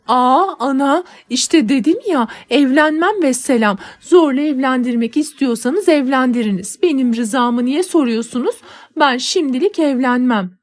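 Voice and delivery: put-on angry girl refusing to marry; defiant outburst. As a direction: fling the refusal at mother. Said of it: "diyerek odadan çıktım".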